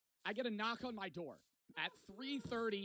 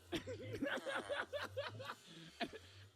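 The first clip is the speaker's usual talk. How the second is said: high-pitched laugh. Real, not acted. Shocked at woman's voice.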